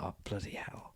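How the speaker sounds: voiceless whisper of oh bloody hell; whispered exclamation. Shown as a demonstration. Whispering it while showing how Aussies swear. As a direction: breathe it out like you forgot something.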